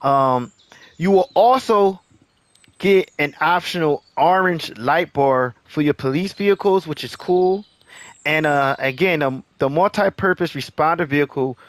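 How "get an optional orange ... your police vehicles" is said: ordinary talk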